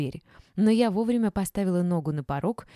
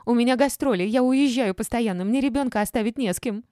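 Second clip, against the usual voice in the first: high, loud, heated excuses; squealed self-defence; 6 semitones higher, 5 dB louder than usual. A narrator's reading. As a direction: protest at the top of your register. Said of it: "взвизгнула Олечка".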